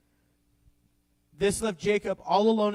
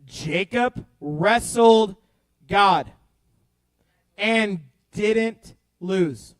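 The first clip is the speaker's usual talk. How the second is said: very slow, every word weighted; slow emphasis; 4 semitones lower, much slower than usual. Shown as a demonstration. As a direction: drag it out to land the point. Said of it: "Let me say that again".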